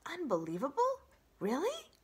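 very high delighted voice; flattered opossum. Acted. Opossum flattered by the robot, very high. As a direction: preen at the praise.

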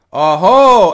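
very loud high indignant oho; a dozen throats at once; crowd shout. A narrator's reading. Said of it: "erscholl es". (lauter empörter Ausruf)

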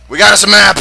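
gloating thug shout; loud thug brag. Acted. Thug voices bragging.

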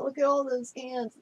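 ordinary delivery

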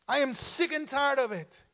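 rising to a high, loud cry of resolve; fervent resolve. Acted. Preacher voicing a desperate leper, crying out he'll move. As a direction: preach it like a desperate sick man ready to go.

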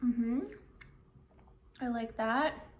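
talking with mouth full; mumbling between bites; chewing. Real, not acted. Seen 4: Holds bitten burger, lips closed and moving, chewing while murmuring.

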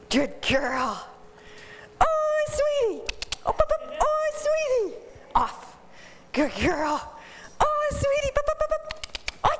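high, excited sing-song calling the dog up; baiting the dog. Acted. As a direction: coax her to jump with a high gushing voice. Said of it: "Can I bait her to do it".

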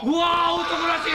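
loud teasing shout. Real, not acted. Cheering the drinker.

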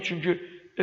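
ordinary talk, nothing marked

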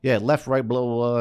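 a stranger waving off a lost man, impatient; brushing him off. Retold or imitated. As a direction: rattle it off like you can't be bothered. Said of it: "He goes".

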